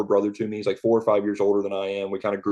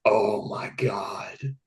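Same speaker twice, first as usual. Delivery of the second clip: groaning despair; 5 dB quieter, much slower than usual. Retold or imitated. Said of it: "I was like".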